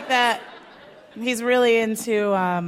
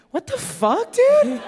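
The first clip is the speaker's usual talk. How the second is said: outraged disbelief; 11 semitones higher, slower than usual. Retold or imitated. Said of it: "And I was like".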